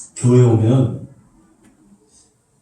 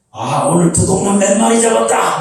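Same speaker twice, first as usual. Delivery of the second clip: high, rising imitated crow; revival preacher's crow; 6 semitones higher than usual. Revivalist on seeing hands go up, crowing high. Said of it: "막 이러는 거예요".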